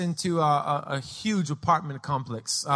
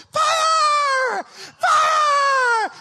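very high, long screams; little girl yelling; 5 dB louder than usual. Retold or imitated. Four-year-old yelling out the window, shrill play scream. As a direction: shriek it like a small child. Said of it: "she starts yelling out the".

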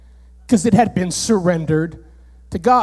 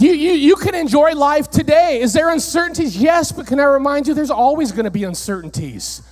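usual speaking voice

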